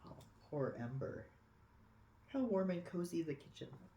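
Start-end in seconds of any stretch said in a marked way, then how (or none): none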